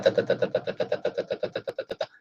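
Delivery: flat, even "da da da" fading to a whisper; mock a flat, toneless rhythm; monotone demo. Shown as a demonstration. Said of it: "you cannot go like".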